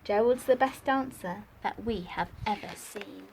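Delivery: put-on lively read-aloud for young children; bouncy rhyming picture-book reading; story narration. A narrator's reading. Teacher reads picture book to class.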